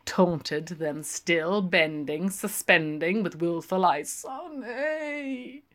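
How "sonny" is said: coaxing call. A narrator's reading.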